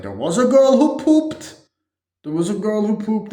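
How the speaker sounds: high, loud put-on voice; hammed-up character outburst. Rambling about the football team, hammed-up high outburst. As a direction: blurt it high and loud, imitating him.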